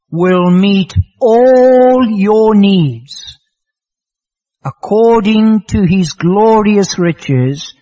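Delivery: proclaimed scripture. Acted. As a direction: ring the verse out with weight. Reads the key verse aloud.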